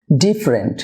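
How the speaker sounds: "ডিফারেন্ট" in two syllables, DIF-rent; correct pronunciation. Shown as a demonstration. Teacher models for viewers, "ডিফারেন্ট" as DIF-rent. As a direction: drop the middle vowel of "ডিফারেন্ট". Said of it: "দুটি syllable হবে".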